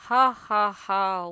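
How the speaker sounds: booming villain laugh, ha ha ha; loud wolf laugh. Acted. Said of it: "और वो जोर से हंसा".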